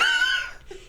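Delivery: sharp high burst of laughter. Real, not acted. Laughing at a commenter calling the rapper fat, high and sudden. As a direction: let out a squealing laugh. Loud laugh mid-story.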